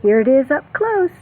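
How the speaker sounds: normal talking voice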